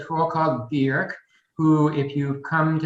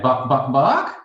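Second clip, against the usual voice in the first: lightly voiced chicken saying bark; 4 dB louder, slower than usual. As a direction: play the silly chicken barking. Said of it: "the chicken says".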